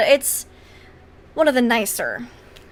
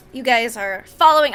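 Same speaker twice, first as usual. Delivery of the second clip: ordinary talk, nothing marked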